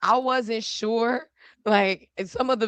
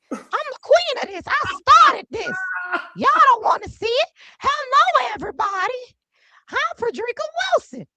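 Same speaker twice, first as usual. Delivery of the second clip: very high, imperious queenly impersonation; Frederica Wilson; 13 semitones higher than usual. Acted. Congresswoman endorses Paulie for council, grand high-pitched impression. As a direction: proclaim it like self-crowned royalty, pitched way up. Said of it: "I have been doing an impersonation".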